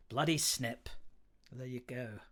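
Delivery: ordinary talk, nothing marked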